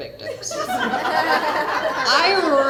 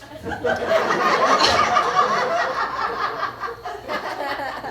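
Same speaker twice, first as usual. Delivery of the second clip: laughter; 12 semitones higher than usual. Real, not acted. Cracking up at own joke.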